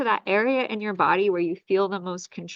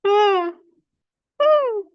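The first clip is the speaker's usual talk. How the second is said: much higher, louder open ah; loud high release cry; 12 semitones higher, 7 dB louder than usual. Acted. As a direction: let out a soaring high ah. Release cries in healing session.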